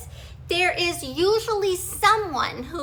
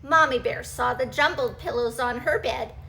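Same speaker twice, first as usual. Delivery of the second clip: ordinary delivery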